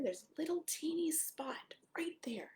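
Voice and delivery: put-on hushed whisper to the child; soft secret whisper. Pointing out tiny spot.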